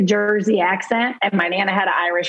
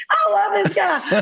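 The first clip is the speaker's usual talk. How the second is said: laughing delight; 10 semitones higher than usual. Retold or imitated. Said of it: "And I was like".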